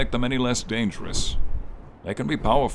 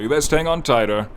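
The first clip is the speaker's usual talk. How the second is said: louder, higher, spirited warning; playful warning; 6 semitones higher, 5 dB louder than usual. Acted. Dragon to knight on its back, spirited playful warning. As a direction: call back to your rider with relish. Dragon warns rider before swooping.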